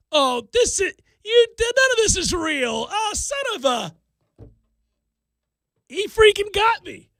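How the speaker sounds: high-pitched reenacted outburst at being fooled; outraged disbelief. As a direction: cry out as if you've just been had. Reenacting discovering the book fooled him.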